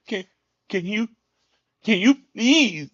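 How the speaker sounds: high, drawn-out whiny begging; slow high-pitched mock plea; mock tearful pleading. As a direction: imitate someone pitifully begging, stretching the please. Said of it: "moaning and crying on the phone".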